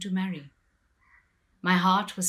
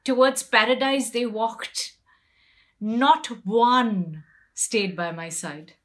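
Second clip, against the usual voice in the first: anguished outcry; 3 semitones higher, 7 dB louder than usual. Acted. Draupadi left behind by her husbands, loud anguish. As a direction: cry out at the husbands walking away.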